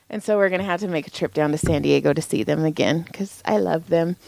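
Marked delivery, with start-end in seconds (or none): none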